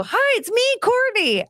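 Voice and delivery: very high, chirpy hello; high sing-song greeting. Retold or imitated. Greeting coworkers the Pam way, very high sing-song. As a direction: copy the quirky greeting as yourself. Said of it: "I'd go".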